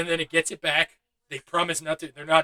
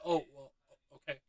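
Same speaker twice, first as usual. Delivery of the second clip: a hint of a timid, mumbling pushover, softer; lightly imitating a meek guy caving in; Michael Cera meek; 2 semitones lower, 12 dB quieter than usual. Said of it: "Like Michael Cera".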